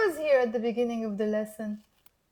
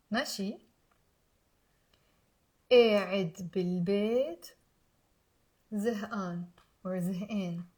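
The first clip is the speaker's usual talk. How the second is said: slow, soft phrases with long pauses; careful, low repeat-after-me delivery; slow teaching demo; 4 semitones lower, 4 dB quieter than usual. Shown as a demonstration. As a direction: leave room for learners to repeat. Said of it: "I'm gonna say it slowly. Pause".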